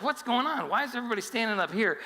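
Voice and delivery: put-on high, fast, wide-eyed kid asking; bewildered little brother blurting questions; puzzled kid. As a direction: voice young David baffled at the sight. David arrives, puzzled.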